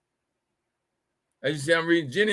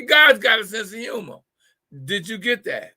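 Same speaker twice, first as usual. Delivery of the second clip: ordinary talk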